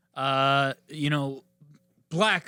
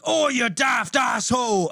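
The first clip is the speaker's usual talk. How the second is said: slow, loud put-on British shout; mock British insult; 5 semitones higher, 4 dB louder, slower than usual. Friend imitating Gordon Ramsay, slow loud British shout. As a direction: bellow it like a furious British chef. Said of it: "he's like".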